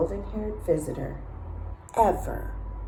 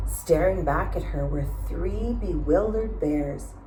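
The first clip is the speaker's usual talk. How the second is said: ordinary delivery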